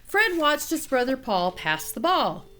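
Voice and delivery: usual speaking voice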